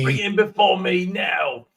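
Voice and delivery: slow, shouted imperial command; cold rasping evil emperor. Retold or imitated. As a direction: bark the order from the throne. Trading evil emperor impressions.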